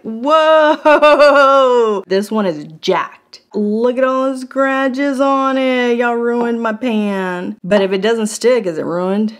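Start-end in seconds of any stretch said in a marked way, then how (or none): 0.0-2.0 long, high, booming whoa; big drawn-out exclamation of shock; loud astonished whoa. Real, not acted. Mock shock at a scratched pan. 3.5-7.6 higher, slow country drawl; country accent. Pretend. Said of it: "Why do I have a country accent today?".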